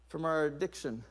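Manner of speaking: ordinary talk, nothing marked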